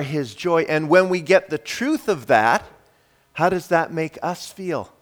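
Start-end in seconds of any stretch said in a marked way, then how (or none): none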